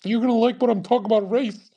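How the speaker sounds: high, fast mock voice; mocking follower. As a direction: rattle it off like a pushy fan. Mocks the follower's pitch.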